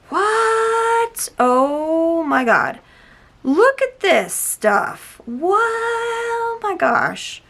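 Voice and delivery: high, long-drawn amazed exclamations; slow, high-pitched wonder; awestruck. Real, not acted. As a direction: stretch every word in sheer disbelief. Marvels at the paint.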